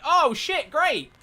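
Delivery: high, loud burst of delight; excited surprise. Retold or imitated. Streamer retelling the community reacting to the news, excited yelp. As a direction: yelp it like great news just landed. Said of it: "and we went".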